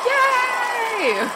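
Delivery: high-pitched crowd-style yay; mock cheering. Shown as a demonstration. Host trying out a cheer effect, high-pitched cheering. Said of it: "add the cheering".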